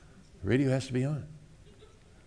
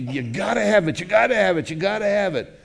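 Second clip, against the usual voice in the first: rising, louder urgent demand; craving flesh; 6 semitones higher, 4 dB louder than usual. Acted. Preacher voicing the flesh's craving, building urgency. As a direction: insist harder each time you say it.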